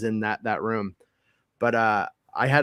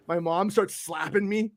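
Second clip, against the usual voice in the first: ordinary talk, nothing marked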